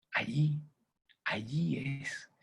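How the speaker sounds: slow, hushed whisper. Acted. Coach whispering the answer to his audience. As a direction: whisper it slowly like a secret. Pointing at the key point.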